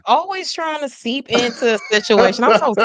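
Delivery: loud, playful mock indignation; mock outrage. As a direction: shout the complaint like a teasing rival. Teasing New Yorkers, mock outrage.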